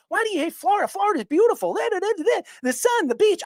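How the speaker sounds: high, sing-song mock of a gushing fan; mocking Florida fan. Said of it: "Everybody keeps asking me".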